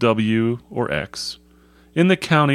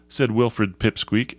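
ordinary delivery

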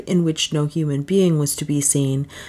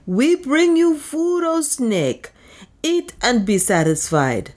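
priest crying aloud; 9 semitones higher, slower than usual. A narrator's reading. Priest offering food to the snake god, crying aloud. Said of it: "cried aloud".

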